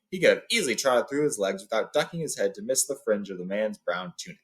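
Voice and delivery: ordinary delivery, nothing marked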